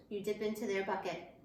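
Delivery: usual speaking voice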